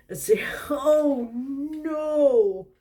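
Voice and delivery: high, loud, drawn-out laughing cry; laughing shriek. Real, not acted. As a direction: laugh out in real alarm. Reader reacts to the crash.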